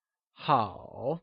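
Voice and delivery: 好 slowly falling, then rising; teacher's drawn-out falling-rising 好; third tone. Shown as a demonstration. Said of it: "also known as the third tone".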